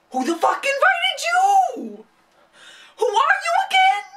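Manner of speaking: high indignant yell; outraged shriek. Real, not acted. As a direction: scream it at the page in disbelief. Outraged rant at a character.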